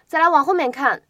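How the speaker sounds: ordinary talk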